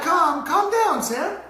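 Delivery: put-on high-pitched pleading baby talk; frantic soothing. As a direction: imitate a clueless teen urgently hushing a baby, voice way up. Retells frantically soothing crying baby niece.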